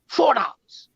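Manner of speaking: a hint of a father hammering home his point; mock-preachy dad; father's dinner-table lecture. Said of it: "And he made it".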